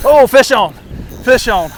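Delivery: loud and high excited call; excited shout. Real, not acted. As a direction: call it out loud as the fish hits. Fish hooked, calls out.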